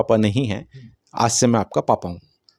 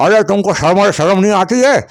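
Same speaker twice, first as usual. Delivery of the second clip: louder, higher furious scolding; father shouting at the boys through the bathroom door, higher and louder scolding; angry scolding father; 5 semitones higher, 5 dB louder than usual. Retold or imitated. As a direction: bawl them out like an annoyed dad interrupted in the bathroom. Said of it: "ये उसको चिल्लाए और उसको डांटे".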